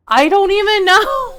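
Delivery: high, loud exasperated cry; baffled outburst. Retold or imitated. Streamer with no idea why it reset, baffled outburst.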